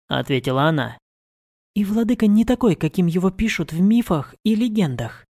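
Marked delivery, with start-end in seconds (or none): 1.7-5.3 higher, quick earnest protest; young woman voice. A narrator's reading. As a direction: defend him eagerly. Mari defends her lord.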